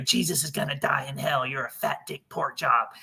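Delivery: lightly voiced kid faking a demon; a hint of a boy putting on a scary voice; eleven-year-old's fake demon voice. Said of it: "making his voice sound like this".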